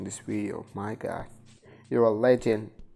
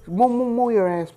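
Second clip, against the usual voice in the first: frantic yell; 10 semitones higher, 9 dB louder than usual. Real, not acted. Yelling at game character to hurry.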